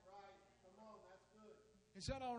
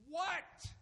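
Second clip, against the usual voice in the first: exasperated father; 10 semitones higher, 4 dB louder than usual. Acted. Father snapping at child.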